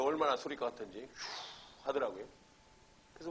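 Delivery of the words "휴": long, heavy mock sigh; imitated deep sigh. Lecturer mimicking a weary student for laughs, deep sigh. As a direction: sigh as if the ground would cave in. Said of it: "한숨을 땅이 꺼져라고 쉬는".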